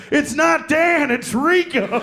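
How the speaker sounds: high, slow, loud insistence; shouted correction. Retold or imitated. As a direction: holler it across a crowd, stretching the words. Acted shouting argument over his name.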